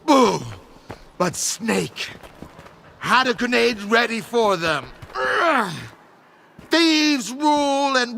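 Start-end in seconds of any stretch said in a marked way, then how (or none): 0.0-0.4 a loud imitated boom; louder mock boom; mock explosion. 5.1-5.7 high cry of pain; a sudden pained cry; a sharp high groan; pained grunt. Retold or imitated. Reenacting being hit.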